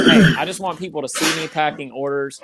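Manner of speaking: ordinary talk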